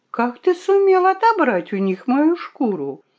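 high-pitched, curious question; eager questioning. A narrator's reading. The fox asks how he got her fur back, eagerly. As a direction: press for the answer, pitch lifted.

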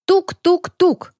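slow, high knock-knock-knock; knocking imitation. A narrator's reading. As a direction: tap out the knocks slowly. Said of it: "снова вернулся".

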